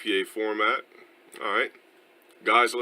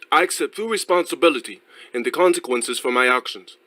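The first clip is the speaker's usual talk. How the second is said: projected, raised-pitch put-on English accent; louder, theatrical English accent, reading formally; demonstrated English accent; 3 semitones higher, 4 dB louder than usual. Shown as a demonstration. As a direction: declaim the apology in a fake English accent. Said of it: "it would be on some shit like that".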